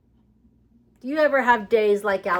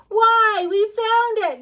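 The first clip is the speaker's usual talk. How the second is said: high, loud cheer for the find; excited; 10 dB louder, slower than usual. Real, not acted. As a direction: celebrate like you found treasure. Finding the letter, excited.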